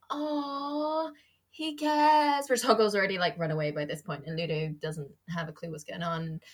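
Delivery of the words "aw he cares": touched. Retold or imitated. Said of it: "I used to be like".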